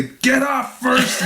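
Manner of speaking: high, slowed mock yell breaking into laughter; imitated corner shout. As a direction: holler it as a joke. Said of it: "As Vitor's corner shouts".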